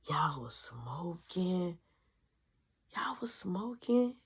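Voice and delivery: slow, hushed, singsong tattle; teasing whisper. Retold or imitated. Sister whispering taunt.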